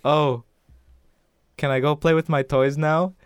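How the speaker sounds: a hint of a British child asking permission; little British girl. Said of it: "a little British girl accent".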